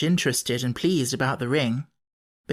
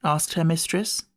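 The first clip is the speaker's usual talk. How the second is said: plain reading voice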